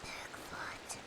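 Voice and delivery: hushed, breathy whisper of the name. Real, not acted. Gamer spotting Bigfoot in the woods, whispering.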